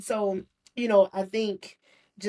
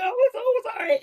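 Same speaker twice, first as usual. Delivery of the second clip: much higher, giggling through the words; laughing apology; 13 semitones higher, 6 dB louder than usual. Retold or imitated. Recalling crying to the therapist, laughing through it.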